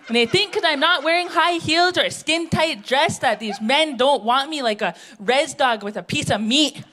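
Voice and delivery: ordinary talk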